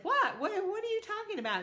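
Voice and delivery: high, fast, puzzled what; grandma startled by the toad's phone call; surprised. Acted. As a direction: caught off guard, rattle it out quickly. Nana baffled by the toad.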